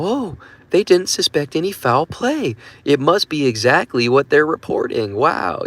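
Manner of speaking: high, mock-naive voice; gullible public. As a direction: parody someone swallowing the news whole. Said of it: "the general public are. They eat this stuff up. They go".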